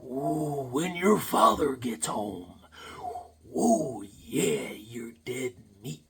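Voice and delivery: slow, breathy raspy threat in a wrestling promo style; Macho Man whisper-yell. Retold or imitated. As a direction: growl it quietly like a wrestler. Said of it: "yell like a whisper".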